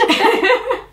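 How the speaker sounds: high-pitched giggling burst; laughter. Real, not acted. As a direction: crack up at your own story. Laughing at own retelling. (risa aguda y espontánea)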